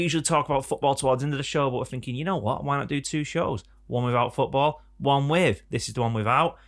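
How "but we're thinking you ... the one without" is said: ordinary talk, nothing marked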